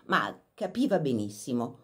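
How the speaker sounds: usual speaking voice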